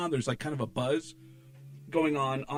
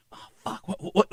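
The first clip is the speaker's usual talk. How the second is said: retold scared stammer; 6 semitones higher than usual. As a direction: imitate a panicked stutter, breath first, then higher. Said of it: "Like, I was so scared".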